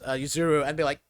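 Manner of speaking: ordinary talk, nothing marked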